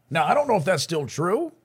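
ordinary talk

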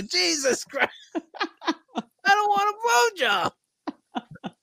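high, slow, laughing mock cry; retelling the embarrassed son, cracking up mid-line; laughing squeal. AJ mortified, retold laughing.

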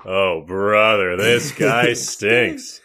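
very slow exasperated drawl; slow groan. Pretend. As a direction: stretch it out, fed up.

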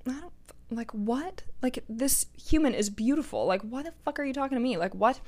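hushed, disbelieving inner voice; breathless quiet disbelief at a compliment; stunned hush. Retold or imitated. Telling how she reacted to the handsome Marine, hushed and stunned.

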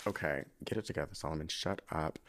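low, soft, hurried self-scolding; subdued after choking up; holding back tears. Real, not acted. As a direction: pull yourself together under your breath. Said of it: "I'm about to start crying".

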